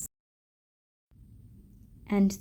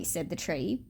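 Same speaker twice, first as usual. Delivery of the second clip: ordinary delivery, nothing marked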